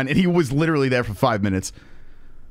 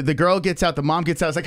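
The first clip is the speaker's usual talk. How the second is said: ordinary delivery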